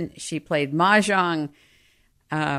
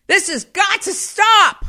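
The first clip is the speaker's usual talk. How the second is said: high, loud exasperated outburst at the table; exasperated mother; 10 semitones higher, 6 dB louder, slower than usual. Retold or imitated. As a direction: yell it at your husband across the table. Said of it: "and go".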